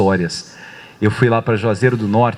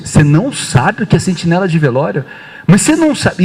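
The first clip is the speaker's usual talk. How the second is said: high, loud mock disbelief, climbing higher; incredulidade zombeteira; 6 semitones higher, 4 dB louder than usual. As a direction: act out an incredulous man who finds it laughable. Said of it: "E ria". (incrédulo, zombando em tom alto e agudo)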